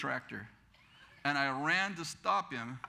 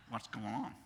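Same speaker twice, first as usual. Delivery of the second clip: low, soft, unhurried question; imitating a calm old grandfather; unruffled grandfather; 7 semitones lower, 7 dB quieter than usual. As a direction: ask it quietly, untroubled by the fuss. Slow grandfather asks, amid the yelling.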